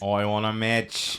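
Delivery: hammed-up Australian accent, slowed right down; mock Australian accent. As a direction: ham up an Australian accent, slowly. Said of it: "She doesn't sound anything like that".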